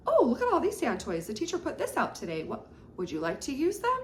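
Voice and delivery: put-on teacher showing a child the sand toys; eager invitation. Shown as a demonstration. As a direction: sound delighted by the new toys. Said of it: "introducing and offering".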